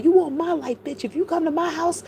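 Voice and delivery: high-pitched imitation of a challenge; mock confrontational quote. Vlogger acts out Allie challenging someone, mock confrontational.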